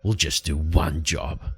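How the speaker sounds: low, grudging Light voice. Acted. Light agrees to one job with Beric, low and grudging. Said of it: "And Light turns to him and goes".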